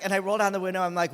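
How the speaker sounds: normal talking voice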